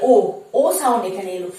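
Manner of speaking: ordinary talk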